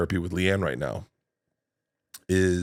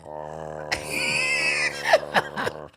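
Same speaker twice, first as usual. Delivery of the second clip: big laugh; 5 semitones lower than usual. Real, not acted. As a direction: burst out laughing at your own bit. Acting out paralyzed uncle bit.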